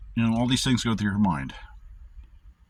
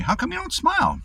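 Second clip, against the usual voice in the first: mock high asker; 3 dB louder than usual. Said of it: "They always ask me".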